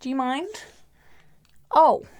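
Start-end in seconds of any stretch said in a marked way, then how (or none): 1.7-2.0 sudden loud, high oh; startled oh as the dog jumps up; startled cry. Real, not acted. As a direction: caught off guard by the dog.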